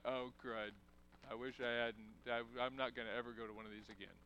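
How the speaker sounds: sheepish self-talk, quiet and quick; embarrassed muttering. Acted. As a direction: hurry it under your breath, wishing you could leave. Embarrassed inner voice after blunder.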